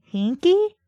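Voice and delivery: high-pitched disbelieving question; incredulous. Real, not acted. Reader balking at an odd word, incredulous.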